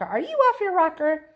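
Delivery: high, loud incredulous outcry; shocked Mister Wonka. A narrator's reading. Mister Wonka scolds Mike, high incredulous cry. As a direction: cry out in disbelief. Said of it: "cried Mister Wonka".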